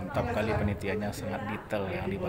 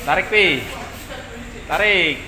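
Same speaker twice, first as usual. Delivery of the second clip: calling out; 7 semitones higher, 5 dB louder than usual. Real, not acted. Calling to helper while hauling.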